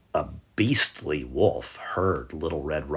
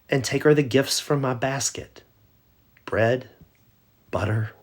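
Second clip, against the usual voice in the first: put-on higher, sweet child's voice; little girl voice; 7 semitones higher, 4 dB louder than usual. Little girl cheerfully answering the wolf, higher voice. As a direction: play the innocent little girl. Girl lists her gifts.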